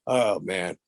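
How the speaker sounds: normal talking voice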